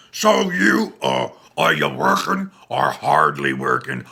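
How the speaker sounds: hammed-up fake mustache guy voice, loud; Colt impression. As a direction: play the smug new boyfriend, loud and put-on. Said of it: "did his best Colt impression".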